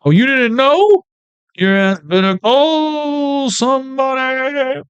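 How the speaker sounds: high, drawn-out teasing; mock taunt. Host taunting viewers who missed out, high and slow. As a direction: tease them in a high slow voice.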